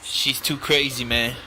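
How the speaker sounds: ordinary talk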